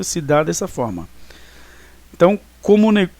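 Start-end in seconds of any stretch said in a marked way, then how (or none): 2.6-3.1 "como ne-" linked, with no separate O between; higher demo of "como" flowing straight into "ne-"; vogais fundidas. Shown as a demonstration. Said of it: "Ele se funde". ("como ne-" ligado, sem o O separado)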